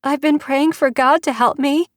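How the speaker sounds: put-on a small girl clinging to hope; frightened child. Child pleading.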